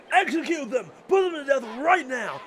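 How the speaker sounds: loud, high demand for execution; furious shout. Acted. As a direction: bellow the order in a rage. Angry official demands execution.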